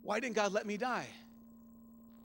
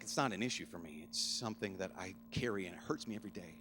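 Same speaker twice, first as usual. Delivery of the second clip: quiet pained quote; 10 semitones lower, 6 dB quieter than usual. Retold or imitated. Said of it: "it's like".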